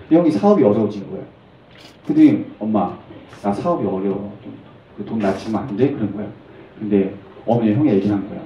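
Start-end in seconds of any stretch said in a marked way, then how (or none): 2.6-5.9 low, soft, sheepish request; subdued plea. Acted. Brother asking mother for money as business fails, subdued plea. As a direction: ask it small and low.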